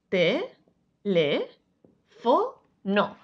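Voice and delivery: te... le... fo... no, one at a time; syllable by syllable. Shown as a demonstration. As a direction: separate syllables with clear gaps. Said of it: "Si dividimos las sílabas".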